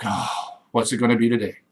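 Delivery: a tired partner bracing for a fight; weary partner. Retold or imitated. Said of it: "they're like".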